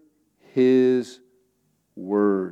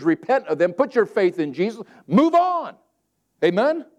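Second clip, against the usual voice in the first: fiery preaching; 8 semitones higher than usual. Acted. Preacher urging the congregation at full force. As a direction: drive it home to the congregation.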